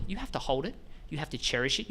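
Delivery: usual speaking voice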